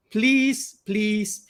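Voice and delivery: ordinary talk, nothing marked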